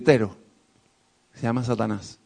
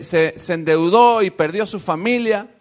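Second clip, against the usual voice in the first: ordinary talk, nothing marked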